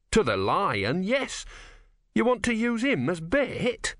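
high, slow, incredulous question; shocked, high-pitched challenge; appalled realisation. Acted. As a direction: climb into disbelief as the plan sinks in. Appalled at the plan.

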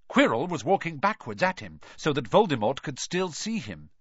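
ordinary delivery

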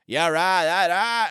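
loud, high, slurred exclamations; a president drunkenly blurting his whole speech; drunk JFK. Retold or imitated. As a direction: belt it like a drunk president on the lawn. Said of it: "It was just him going".

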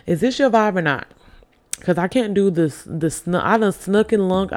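ordinary talk

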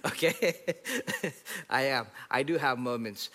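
normal talking voice

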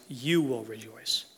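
slow, low line with "You" drawn out; stress on YOU. Real, not acted. Preacher giving the promise to the brokenhearted, lingering stress on "You". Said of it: "emphasis on the word "you".

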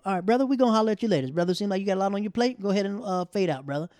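loud, fast teasing buddies; friends hollering. Retold or imitated. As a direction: brush him off with a big laugh.

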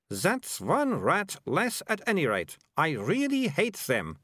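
put-on slow, high-pitched girl's voice; disdainful story girl. A narrator's reading. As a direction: vehement rat-hater, drawn out.

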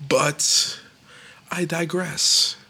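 very slow, grand I digress; drawn-out digress. Acted. Comedian changing topic, slow pompous I digress. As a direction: stretch it out pompously.

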